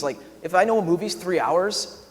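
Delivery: ordinary talk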